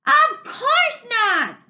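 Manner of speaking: high, loud and slow; shouting over the river. Acted. Arlen shouting no to Dune over the river. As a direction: call the refusal over the roar.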